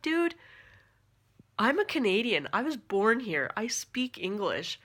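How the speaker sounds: fed up, setting him straight; exasperated. Retold or imitated. Exasperated retort to condescending cousin.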